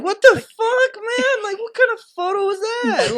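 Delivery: very high, whiny wail; mock anguish. Fan embarrassed by the photo pose, mock anguish. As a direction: squeal in exaggerated despair at yourself.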